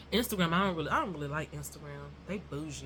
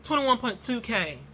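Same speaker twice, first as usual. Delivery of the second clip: too fast; 5 semitones higher, 6 dB louder than usual. Shown as a demonstration. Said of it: "I said it too fast".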